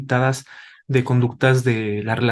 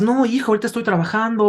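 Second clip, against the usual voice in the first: lightly voiced, higher dismissive parent; busy parent; 7 semitones higher, faster than usual. Father turns down his son's request to play, mildly dismissive. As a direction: brush the child off, mildly impatient. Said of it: "Y los papás".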